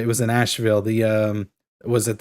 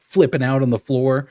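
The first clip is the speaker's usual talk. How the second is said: ordinary talk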